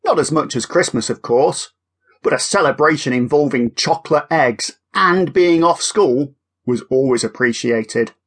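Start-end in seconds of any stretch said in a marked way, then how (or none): none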